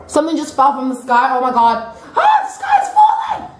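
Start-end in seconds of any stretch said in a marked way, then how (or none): 2.2-3.5 very high panicked squeal; Chicken Little. Acted. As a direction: squeak it out in mock alarm. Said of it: "I feel like I'm in Chicken Little".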